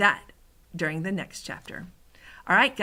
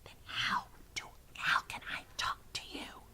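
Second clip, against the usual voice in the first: barely audible, halting whispered question; 12 dB quieter than usual. A narrator's reading. Sophie asks the giant how to talk, whispering. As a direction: ask in the tiniest whisper. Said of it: "Sophie whispered".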